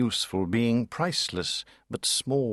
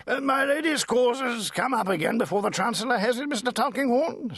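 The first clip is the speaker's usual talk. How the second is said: put-on high, fussy voice of an elderly gentleman; pompous old baronet; 13 semitones higher than usual. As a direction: play the proud old lord greeting his lawyer. Sir Leicester voiced in reading.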